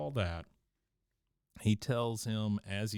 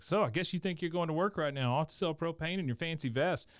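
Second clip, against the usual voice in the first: higher-pitched mock annoyance, lightly acted; pissed-off Luann; 6 semitones higher than usual. Niece sniping at Hank as he leaves for work, mock pissed off. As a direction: play at being ticked off, pitched up. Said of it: "really pissed off at Hank".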